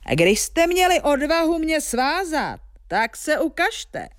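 high giant shout, slowing at the end. A narrator's reading. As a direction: dare them to show themselves, giant voice. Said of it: "křičel obr".

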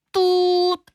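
high, honking horn imitation; mock honk of traffic; car horn. A narrator's reading. Car horns at a crossroads.

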